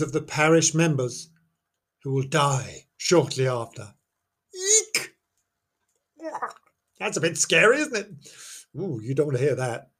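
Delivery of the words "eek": high squeaky eek; mock squeal of fright. Teller squeals at the ghost story's omen, mock fright. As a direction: squeal like a startled mouse.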